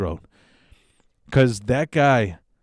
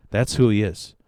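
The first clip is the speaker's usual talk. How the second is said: ordinary talk, nothing marked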